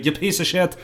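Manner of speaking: lightly put-on Irish accent; mock Irish ancestor cursing. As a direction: correct it into an Irish brogue. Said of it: "more accurately".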